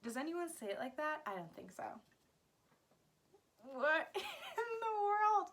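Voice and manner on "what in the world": high, drawn-out astonishment; baffled disbelief. Real, not acted. Vlogger meets a monster word, baffled high-pitched disbelief. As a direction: stretch it out, pitched way up, half laughing. Reacting to an impossibly long word.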